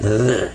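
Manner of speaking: "the" as a low sustained buzz; heavy-duty TH. Shown as a demonstration. Teacher asks to hear students say it, heavy buzzing TH on "the". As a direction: let the TH in "the" buzz long and low. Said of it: "heavy duty".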